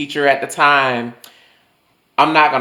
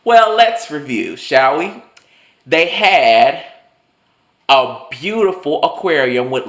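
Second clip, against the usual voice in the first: sarcastic recital; 3 semitones higher than usual. Pretend. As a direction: recite it with exaggerated, deliberate patience. Sarcastic mock answer to teacher.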